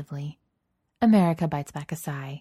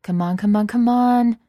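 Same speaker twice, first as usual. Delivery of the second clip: rising, louder urging; egging on; 7 semitones higher, 10 dB louder than usual. Acted. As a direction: goad her on, building. Egging on in a game.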